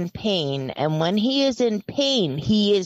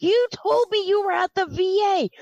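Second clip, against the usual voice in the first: exasperated retort; 12 semitones higher than usual. Retold or imitated. Retold quarrel with exasperated partner.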